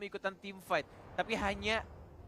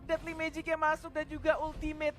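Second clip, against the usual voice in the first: high, loud play-by-play shout; hyped esports casting; 11 semitones higher, 6 dB louder than usual. Acted. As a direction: call the kill with rising hype. Casters hype a first blood.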